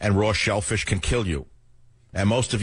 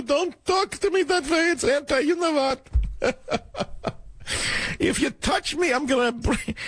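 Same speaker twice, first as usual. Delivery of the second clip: high-pitched mincing mockery throughout; mock high whiny voice. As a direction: squeal it out, sneering impersonation. Mocking Sheldon Silver type voice.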